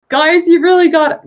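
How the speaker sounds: youthful high voice, pitched well up; young boy. Acted. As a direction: play a young attendant pleading with rowdy guests. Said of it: "a pockmarked young boy".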